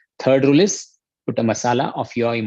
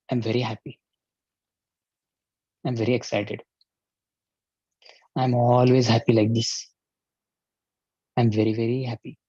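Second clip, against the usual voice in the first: slumped and flat. Shown as a demonstration. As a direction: say it looking down, no energy. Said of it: "shoulders down and look down".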